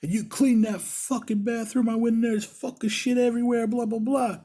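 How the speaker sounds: high-pitched mock tirade; hammed-up angry rant, pitched well up; pretend furious. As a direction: chew out the staff in fake outrage. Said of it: "just acting a fucking fool".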